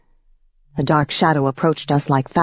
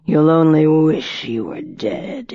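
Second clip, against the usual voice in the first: lower, slow and cold vampire voice; a predator savoring every word; menacing old vampire; 4 semitones lower, slower than usual. A narrator's reading. Piscary threatens Rachel.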